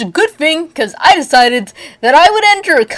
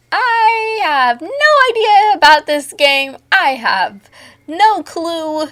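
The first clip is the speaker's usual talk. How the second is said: mock clueless; 5 semitones higher, slower than usual. High mock-confused intro.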